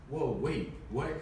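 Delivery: ordinary delivery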